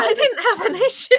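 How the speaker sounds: high-pitched protest breaking into laughter; giggly, squeaked denial; laughing insistence. Acted.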